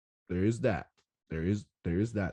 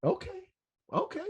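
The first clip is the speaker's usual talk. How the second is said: high, fast squeal of shocked disbelief; stunned disbelief; faster than usual. Retold or imitated. Fan at the rail looking back at a crowd, high shocked squeal. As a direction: gasp it out like you can't believe the crowd. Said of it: "I'm like".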